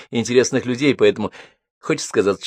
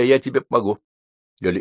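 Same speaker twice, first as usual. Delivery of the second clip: ordinary delivery, nothing marked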